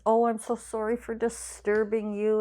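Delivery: imitating Mom saying sorry; apologetic mother. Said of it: "She's always".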